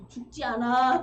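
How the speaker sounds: loud, blunt reassurance; mock boss bark. Boss telling a worker he won't die, loudly. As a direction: brush off the complaint like a boss.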